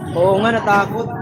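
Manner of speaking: usual speaking voice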